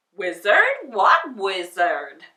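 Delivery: loud, slow puzzled question; puzzled scarecrow. Acted. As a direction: scarecrow baffled, stretching it out.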